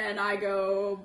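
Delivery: ordinary delivery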